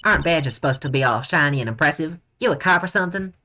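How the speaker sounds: indignant Southern countrywoman; hammed-up Southern telling-off; Southern accent. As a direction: scold in a put-on Southern accent. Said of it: "I can't do a southern accent".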